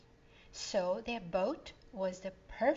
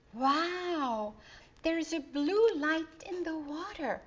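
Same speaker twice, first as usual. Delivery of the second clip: put-on awed boy voice, a bit higher; exclaiming; 7 semitones higher, slower than usual. A narrator's reading. Bo amazed by the glowing water, excited. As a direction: marvel at the blue light. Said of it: "exclaimed Bo".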